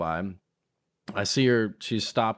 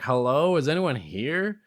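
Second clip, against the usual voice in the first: mild mock yell, higher pitched; retold yell; 6 semitones higher, slower than usual. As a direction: call out into an empty building, half-voiced. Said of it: "I was yelling".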